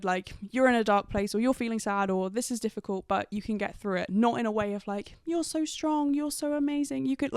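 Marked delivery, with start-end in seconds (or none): 5.3-7.3 higher, sing-song mock reassurance; imitating a friend's patronizing cheerleading; patronizing praise. Mocking the friend's dismissive pep talk, patronizing. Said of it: "it just felt very dismissive and very patronizing".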